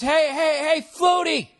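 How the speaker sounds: high shout, stumbling on the first word; repeated false starts into a high call; stuttering heckle. Retold or imitated. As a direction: imitate a buddy stammering into a heckle. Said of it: "He kinda stutters".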